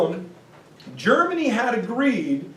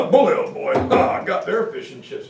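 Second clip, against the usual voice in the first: hammed-up posh British cheer, laughing through it; mock British accent; 3 semitones lower than usual. British smugly celebrating the treaty, in a hammed-up British accent. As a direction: play the smug British with a laugh. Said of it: "the British are".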